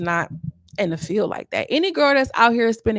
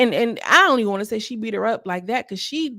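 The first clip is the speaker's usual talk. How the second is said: ordinary talk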